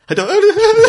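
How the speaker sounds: the line dissolves into giggles, way up high; alchemist breaking into laughter. Acted. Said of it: "And then he goes".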